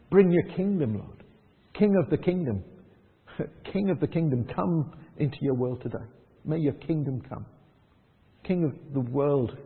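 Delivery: soft, low, pleading prayer voice; a quiet intercession for Penrith; congregational prayer. Acted. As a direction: pray it gently over the church. Leads a prayer for mercy.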